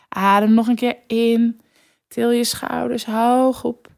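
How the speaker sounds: guided breathing. Acted. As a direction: guide the listener to relax. Leads a breathing exercise.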